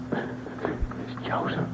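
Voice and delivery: hushed whispered news. Acted. Son whispering into his father's ear, hushed.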